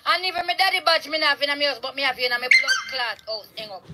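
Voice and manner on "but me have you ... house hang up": ordinary delivery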